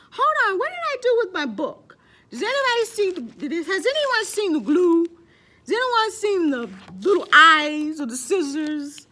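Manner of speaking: high, loud, flustered hunt for her things; frazzled past self. Retold or imitated. Teacher mocking her old frazzled self to the class, high and loud. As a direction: play her own scattered self searching for the glue. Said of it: "And I would say".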